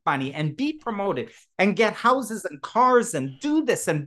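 rising to a joyful near-shout at the end; excited. Retold or imitated. Excited retold speech, building.

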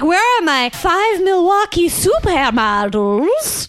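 slow, high mock British drawl; mock English accent. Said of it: "That's my very good English accent".

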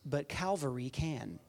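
soft, sure retold reply; hushed, assured answer; quiet assurance. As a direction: state it gently with certainty. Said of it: "with assurance".

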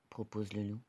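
usual speaking voice

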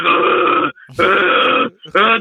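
long throaty moan, mimicked; mock heavy groaning, drawn out; imitated grunts. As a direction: groan like the man next door. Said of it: "but he's like".

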